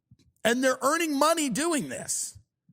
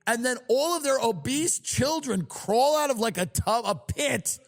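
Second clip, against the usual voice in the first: usual speaking voice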